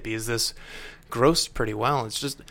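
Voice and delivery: ordinary delivery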